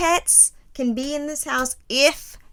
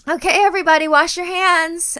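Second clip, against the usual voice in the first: high, loud, sing-song chirp; fake-cheerful mom voice; 7 semitones higher, 6 dB louder than usual. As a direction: put on a bright fake-cheerful tone. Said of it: "I have to go".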